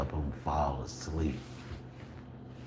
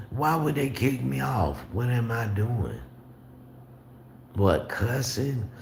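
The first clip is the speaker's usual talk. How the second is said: incredulous; 7 semitones higher than usual. Real, not acted. Incredulous at the accusation.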